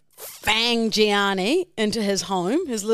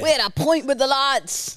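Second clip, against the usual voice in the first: higher mock London accent slipping into Irish; slightly Irish London accent; 6 semitones higher than usual. Imitating London lads at the pub, accent turning Irish. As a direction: attempt London lad, landing a bit Irish. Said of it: "That's a bit Irish".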